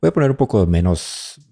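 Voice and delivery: usual speaking voice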